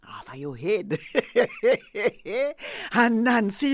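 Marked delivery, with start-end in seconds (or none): 1.0-2.9 loud gloating laugh; sly laugh. Acted. Snake gloats over Nancy's head with a laugh. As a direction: laugh with menace.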